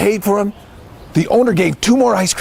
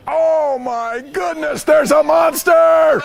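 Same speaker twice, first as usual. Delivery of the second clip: high, drawn-out cry of horror; slow, high wail of alarm for children; storybook alarm; 6 semitones higher, slower than usual. Acted. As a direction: stretch it out in hammed-up fright. Rabbit sees the monster.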